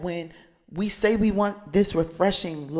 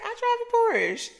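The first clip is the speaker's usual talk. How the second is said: very high, breezy "I drive a Porsche"; high-pitched imitation of a friend bragging lightly; mock casual flex. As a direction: toss it off like it's nothing, up high. Friend's reply retold in quote.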